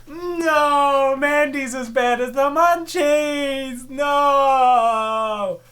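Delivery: high, loud, drawn-out no; loud high protest, slowed; dismayed shout. Real, not acted. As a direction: yell at the screen in dread. Commentator dreads Mandy's arrival.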